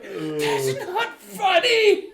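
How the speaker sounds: high, loud, slow scold through laughter; laughing scold. Retold or imitated. Mom in the car scolding the laughing daughters. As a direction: try to scold while cracking up. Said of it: "my mom's like".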